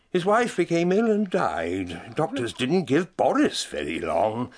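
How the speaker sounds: ordinary talk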